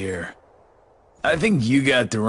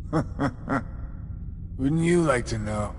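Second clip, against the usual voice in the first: slow tease; 4 dB quieter, much slower than usual. Acted. Villain teases hero.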